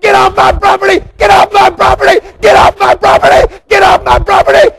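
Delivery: very high, loud repeated order; furious screaming. Retold or imitated. Pastor screaming at couple to leave, shrieking high in rage. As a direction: scream it over and over, out of control.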